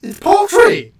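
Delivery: high-pitched, incredulous protest; exasperated shouting. Real, not acted. Co-host challenging friend over turkey and fried chicken, exasperated shouting. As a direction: argue it at the top of your voice.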